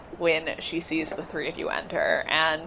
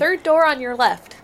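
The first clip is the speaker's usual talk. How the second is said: cheerful receptionist; 8 semitones higher, 6 dB louder than usual. Acted. GM voices receptionist, higher, louder.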